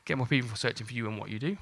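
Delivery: normal talking voice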